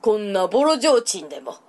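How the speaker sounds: put-on musing old monk, low; creaky wise old man.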